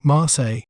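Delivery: Marseille said as "mar-SAY", falling at the end; careful pronunciation. Shown as a demonstration. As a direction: model "Marseille" with silent L's. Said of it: "We may pronounce it as".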